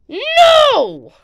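high, loud drawn-out no; shouted refusal. Pretend. Refusing to hand over the grab pack, shouted refusal.

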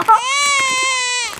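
high laugh falling off; whimpering laugh. Real, not acted. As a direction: laugh it out, half crying. Laughing after the sour candy.

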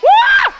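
high loud stage scream; mock startled scream. Magician fakes a fright for the children, scream. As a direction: shriek as if the trick shocked you. Trick reveal.

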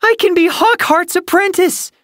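very high, loud squeal of joy; excited shout. A narrator's reading. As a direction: burst out with thrilled news. Young cat bursts in with news.

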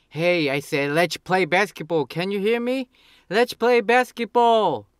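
loud, high, exasperated demand; angry. Shown as a demonstration. To a friend glued to their cell phone, angry. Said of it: "Then you get angry and say".

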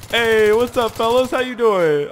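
loud, high-pitched cocky hail; playful shouted greeting; calling out a greeting. Pretend. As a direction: holler hello to the fellas. Hails enemies in game.

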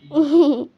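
short burst of laughter; a quick laugh out loud. Real, not acted. Laugh on its own.

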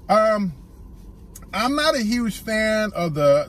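usual speaking voice

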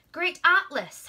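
loud high call across the mountain. Acted. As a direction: call up to the giant. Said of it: "Hercules called".

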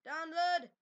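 loud, forceful "Download"; emphatic repeat. Real, not acted. Same word repeated with more force.

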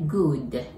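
"Good" with a faint extra u after the d; teacher's pronunciation demo; small u after d. Shown as a demonstration. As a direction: finish "Good" with a tiny u sound. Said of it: "ちっちゃいうをつけて、Goodと言うんですよね".

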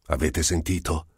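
ordinary delivery

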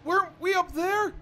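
scared whine. Real, not acted. Player creeping through a dark level, frightened and whining. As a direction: drag the words out in real fear.

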